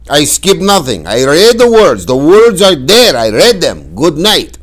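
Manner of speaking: loud, high, clipped in temper; upset father. Retold or imitated. Father ending the argument with his son, loud upset outburst. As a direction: shut the argument down hard. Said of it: "more than a tiny bit upset".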